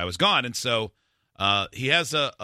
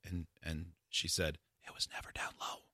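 whispered lines, much softer; 6 semitones lower, 14 dB quieter than usual. Pretend. Telling friends the doctor's words in a whisper. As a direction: whisper it for someone else to repeat. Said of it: "Just whisper. Whisper it".

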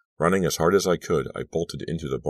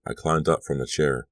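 plain reading voice

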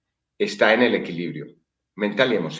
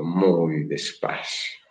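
very slow, quieter repeat; softer, slower echo; 5 semitones lower, 4 dB quieter, much slower than usual. Shown as a demonstration. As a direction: let it fade out slowly.

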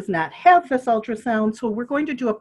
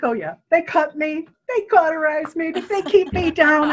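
higher, laughing disbelief; mock-laugh as the husband; 7 semitones higher than usual. Husband laughs off his vasectomy, lightly laughing. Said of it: "And he starts to laugh".

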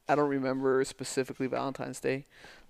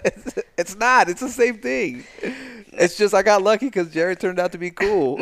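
loud protest; 7 semitones higher, 9 dB louder than usual. Real, not acted. Playful argument with partner.

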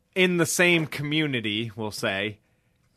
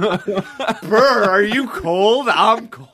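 high, loud, hammed-up shivering with a laugh; mock shivering; 7 semitones higher, 7 dB louder, much slower than usual. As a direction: pretend to freeze, teeth chattering. Mock shivering in hot room.

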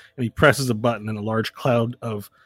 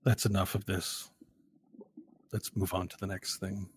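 soft, low order; hushed, low dismissal; quiet principal; 3 semitones lower, 12 dB quieter than usual. Acted. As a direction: end it softly and calmly. Principal speaks in story.

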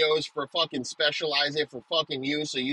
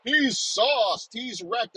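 much higher, prissy gossip voice; mocking gossip; 8 semitones higher, much slower than usual. Mocking people gossiping that he is drunk, high and prissy. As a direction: tattle it like a scandalised neighbour.

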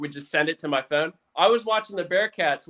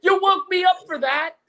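annoyed wife; 12 semitones higher, 5 dB louder than usual. Retold or imitated.

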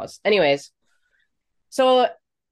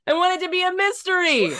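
coy joke; 10 semitones higher, faster than usual. Pretend.

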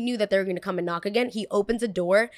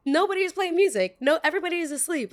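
exasperated friend; 7 semitones higher, faster than usual. Retold or imitated. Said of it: "this is when Pete was like".

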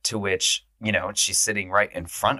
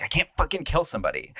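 higher-pitched, fast panicky protest; stressed; 7 semitones higher, faster than usual. Retold or imitated. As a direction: imitate someone stressed out, blurting it quickly. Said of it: "stressed by this".

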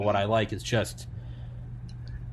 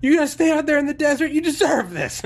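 high, loud, fast gloating yell; mocking shout; 7 dB louder than usual. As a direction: gloat at them, yelling. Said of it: "He goes".

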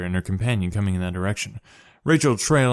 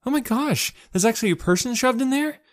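much higher, astonished exclamation; surprised exclaim. A narrator's reading. Sho finds a boy shoved in a locker, surprised. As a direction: gasp it out in shock. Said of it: "Sho exclaimed in surprise".

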